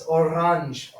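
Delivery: "orange" as o-RAHNZH, open second vowel; French-derived orange. Shown as a demonstration. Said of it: "that was also pronounced".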